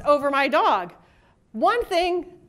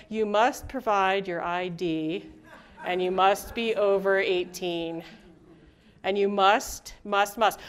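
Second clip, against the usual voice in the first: lower, softer, slow bureaucratic drone; stern form voice; 7 semitones lower, 5 dB quieter, slower than usual. Pretend. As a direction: read it like a cold rule list, low and slow. Reading a strict application form.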